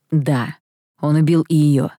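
understated, matter-of-fact dry answer. A narrator's reading. Angel confirming the demon killed her, drily. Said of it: "сухо ответил Кайл".

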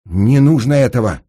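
loud, high cry to stop her; urgent plea. Acted. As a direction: call out urgently, pleading. Engineer pleads with armed woman. (громкая настойчивая мольба)

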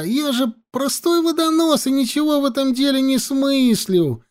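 high-pitched pleading whine; servile whimpering. A narrator's reading. Lowly water carrier pleading ignorance to a sergeant, whimpering. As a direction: whine it like a cringing servant. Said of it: "захныкал Живан, снова подобострастно кланяясь".

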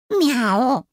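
imitated cat complaint; indignant meow. A narrator's reading. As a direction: meow back in objection. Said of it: "возмущённо мяукнул тот самый Люцифер".

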